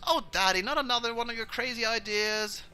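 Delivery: high, loud daughter voice; high-pitched, despairing protest; exasperated daughter. Acted. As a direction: scold Daddy in a high voice. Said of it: "And Cinna goes".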